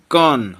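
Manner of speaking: कन held long on the final n; sound demonstration. Shown as a demonstration. Teacher shows the anusvara's sound, कन drawn out. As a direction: stretch कन so the n rings out. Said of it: "यहाँ पे भी उच्चारण हमको जब कर रहे हैं".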